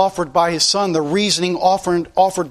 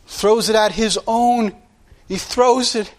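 normal talking voice

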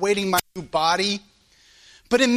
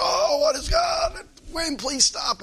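a cracking, much higher cry of frustration; exasperated cry. Retold or imitated. Man sprayed with oil crying out at the wind. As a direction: throw the words up at the sky in exasperation. Frustrated outburst under the car.

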